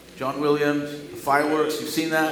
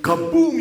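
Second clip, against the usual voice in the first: sung kaboom; 10 semitones higher, 7 dB louder than usual. Acted. Fourth movement finale.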